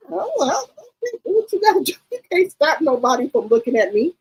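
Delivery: high, quick breezy brush-off; mock dismissive. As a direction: wave it away airily.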